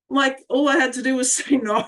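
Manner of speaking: real laugh breaking into a sob; laughing through tears. Real, not acted. Painful realisation, laughing through tears.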